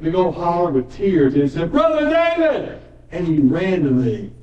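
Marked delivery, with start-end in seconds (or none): 1.7-2.8 high, drawn-out cry of a name; a grieving man crying out a name, high and slow; hollered name. Retold or imitated. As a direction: call out a name through tears.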